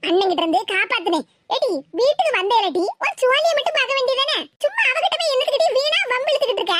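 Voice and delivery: ordinary talk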